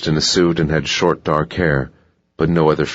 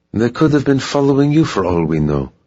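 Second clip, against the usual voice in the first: higher-pitched voice of protest; 8 semitones higher, 3 dB louder than usual. A narrator's reading. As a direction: object anxiously, pitch raised. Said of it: "protested Giselle".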